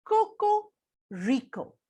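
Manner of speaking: slow, clear "Coco Rico"; "Coco Rico" spelled out syllable by syllable; slow modelled name. Shown as a demonstration. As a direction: say "Coco Rico" slowly for the kids to repeat. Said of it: "Can you say that after me".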